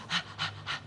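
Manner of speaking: soft breathless panting, unvoiced. Actress retelling a take, imitating her own panting. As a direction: pant like a dog out of breath. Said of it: "Parece un perro jadeando".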